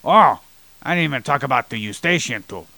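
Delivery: ordinary talk, nothing marked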